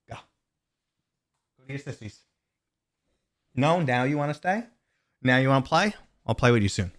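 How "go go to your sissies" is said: pet talk. Real, not acted. Sending the dog off.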